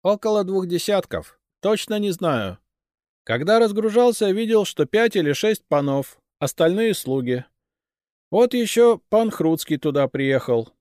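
farmer's son reporting under questioning; captive youth answering questions. A narrator's reading. Narrator voices the captive youth.

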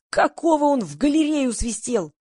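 high, slowed indignant holler; narrated straining yell, moderate volume. A narrator's reading. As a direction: stretch the angry question out high. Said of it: "надрывался Григорий".

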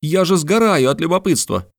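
high, eager burst of curiosity; burning with curiosity; impatient exclamation. A narrator's reading. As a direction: plead high-pitched for the answer. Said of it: "воскликнул Мишель Ардан".

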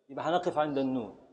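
ordinary talk

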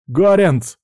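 loud, high-pitched anxious call; shout. Acted. Friend searching after the shot, anxious shout. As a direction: shout for a friend through the smoke. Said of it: "крикнул я".

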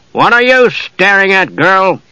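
high, slow menacing thug; angry demand. A narrator's reading. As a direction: draw it out as a challenge. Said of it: "Lynch demanded angrily".

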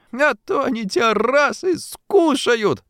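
put-on high, drawn-out mischievous child's voice; laughing teasing girl. A narrator's reading. Daughter teasing father about young ladies, high gleeful voice. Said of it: "Помирая со смеху, подхватила Шурка".

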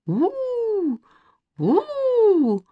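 high, long animal howl; spooky drawn-out "ooo". A narrator's reading. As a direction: howl like jungle beasts. Boy imitates wild beasts howling.

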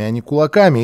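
ordinary delivery, nothing marked

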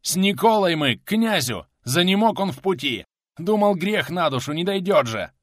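loud, high pleading explanation; urgent shout. A narrator's reading. Милован explaining his sick companion to riders, urgent shout. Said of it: "крикнул Милован".

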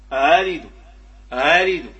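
آلد loud, second hamza halfway to alif; half-formed second hamza gliding in آلد; eased second hamza. Shown as a demonstration. Teacher repeating the tashil model, آلد with second hamza eased. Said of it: "تنطق شيء من الهمزة وشيء من".

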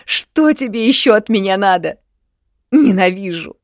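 high, loud, desperate cry; tearful outburst. A narrator's reading. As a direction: hurl it out through tears. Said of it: "заплакала девушка". (сквозь слёзы, с отчаянием и злостью)

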